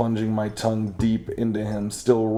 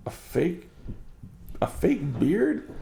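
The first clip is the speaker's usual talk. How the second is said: incredulous; 6 semitones higher, 3 dB quieter than usual. Acted. Realizes the beard is fake.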